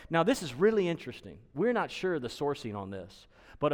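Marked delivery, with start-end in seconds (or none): none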